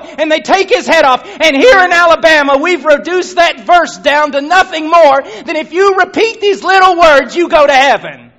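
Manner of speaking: high, loud, building sermon; preaching at full force. Acted. Preacher to congregation, fervent and loud. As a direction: pound the point into the congregation.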